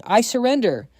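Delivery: high, loud cry of surrender; shouted surrender. A narrator's reading. Alan drops to his knees before his brother, shouting. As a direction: shout it out, pitched well up.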